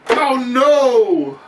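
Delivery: slow, stretched, loud cry of alarm; shocked oh no. Pretend. Announcer seeing Ming waylaid with a belt, stretched shocked cry. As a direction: drag out the dismay like the worst just happened. Sudden attack in ring.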